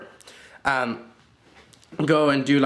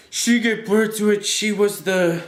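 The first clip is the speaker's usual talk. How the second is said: high-pitched mock rant; insistent, pitched-up complaint; mock ranting wife; 7 semitones higher, 3 dB louder than usual. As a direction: imitate a wife going on and on.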